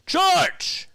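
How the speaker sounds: full-throated CHARGE shout; stadium charge yell. Shown as a demonstration. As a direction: scream it like the ballpark organ cue. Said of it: "I gotta be like".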